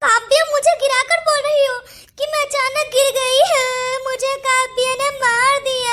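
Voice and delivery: whining, wailed complaint; hammed-up crying victim; tearful accusation. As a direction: stretch the last words into a sob. Fallen woman tearfully accusing.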